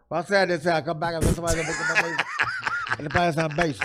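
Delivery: mild mock garbled interview answer; Mickey Rivers impression. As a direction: slur it like Mickey Rivers, barely coherent. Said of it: "exactly what happened".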